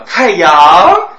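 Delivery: 太阳 with 阳 stressed high and loud, comic; 太阳 with the wrong, joking stress on 阳. Shown as a demonstration. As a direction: push the 阳 of 太阳 up, jokingly. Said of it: "除非你有意思搞笑".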